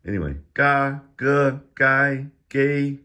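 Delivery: each syllable called out loud and high, slowly spaced; drilling syllables. Shown as a demonstration. Teacher drilling Chinese initials for viewers, loud high enunciation. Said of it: "I'm going too fast".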